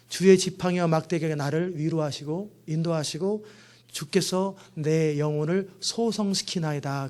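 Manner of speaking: slow, reverent reading of a psalm; quoting a psalm to the congregation, slow and reverent; psalm recitation. Acted. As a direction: draw the psalm out slowly and reverently.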